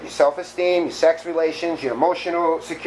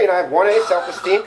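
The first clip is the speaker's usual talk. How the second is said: normal talking voice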